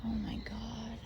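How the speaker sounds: breathless whispered oh my God; awed whisper. Real, not acted. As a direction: hush it like something's listening. Creepy graveyard finds, whispering.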